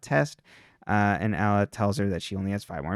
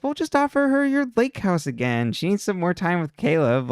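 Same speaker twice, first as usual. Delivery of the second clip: snarky; 7 semitones higher than usual. Retold or imitated. Snarky friend quote in recap.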